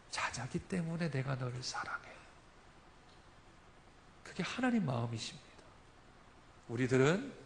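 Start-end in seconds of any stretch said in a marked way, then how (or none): 0.1-2.1 soft, unvoiced whisper of love. God whispering love to his child. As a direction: whisper it close like a loving father.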